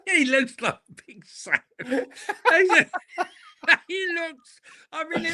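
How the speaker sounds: words breaking up with laughter; helpless amusement; laughing while talking. Real, not acted. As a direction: squeeze the line out through giggles. Cracking up at the impression.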